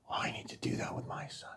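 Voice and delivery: real emotion, reduced to a whisper; quick, voiceless words through a tight throat; choked up. Real, not acted. Father retelling what others said after hearing of the ceremony, choked up. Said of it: "I'm gonna get emotional talking about this".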